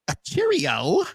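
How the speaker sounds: a quick fake British "cheerio"; mock British accent. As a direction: toss it off in a put-on British accent. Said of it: "your accent is really heavy to me".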